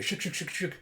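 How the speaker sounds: rapid repeated shaking noise; imitated dog shake. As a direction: make the noise of wet fur shaking. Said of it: "As he shakes off all the wetness".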